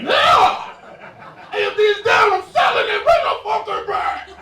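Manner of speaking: wild-eyed TV finance man losing it; hammed-up manic stock-show yell; frantic Jim Cramer.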